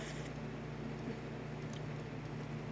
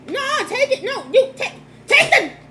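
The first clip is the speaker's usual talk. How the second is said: laughing; 9 dB louder than usual. Real, not acted. Laughing refusal with roommate.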